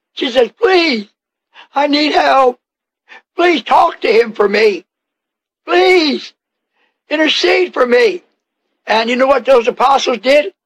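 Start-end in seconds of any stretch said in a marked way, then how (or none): 0.5-8.2 acted plea, each "please" pitched high; pleading. Preacher voicing the woman begging the disciples, pleading. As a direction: implore them as a desperate woman would. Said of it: "she pleads with them".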